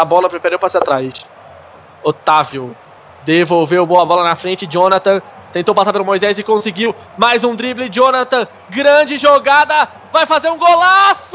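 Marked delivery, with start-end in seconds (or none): 5.5-11.4 from eager to shouting, climbing high; rising goal commentary. Real, not acted. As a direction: build up to a full goal shout. Goal attack building up.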